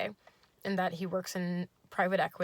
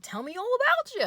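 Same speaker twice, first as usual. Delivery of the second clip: much higher, louder chirpy okay; high yay voice; 6 dB louder than usual. Retold or imitated. Date agrees in a high goofy chirp. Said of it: "He's like".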